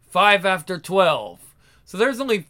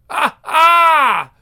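higher panicked crying out; frightened cry; 7 semitones higher, 8 dB louder than usual. Acted.

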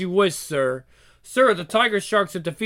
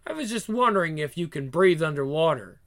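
normal talking voice

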